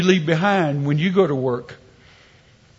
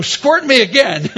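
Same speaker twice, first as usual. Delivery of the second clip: higher, louder mock-eager plea; eager demand; 6 semitones higher, 5 dB louder than usual. Patient asking doctor for another dose, mock-eager shout. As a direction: play the patient delighted by the drug. Acted drugged patient begging for more.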